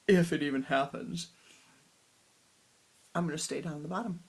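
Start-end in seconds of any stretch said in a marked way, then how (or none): 0.1-1.3 louder mock of the cheeky line; mocking the disciple. Guru laughingly parrots his disciple, mocking. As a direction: throw his words back at him, teasing. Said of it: "this is not such a nice way to talk to your guru".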